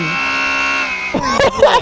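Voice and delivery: very high shrieking laugh. Real, not acted. Thrilled at speed.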